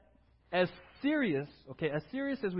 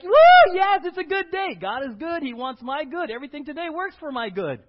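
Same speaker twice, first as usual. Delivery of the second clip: giddy shout of praise; enthusiastic cheer; 7 semitones higher, 13 dB louder than usual. Pretend. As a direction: whoop high and loud, then gush that it's a good day. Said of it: "Are you enthusiastic? Are you like".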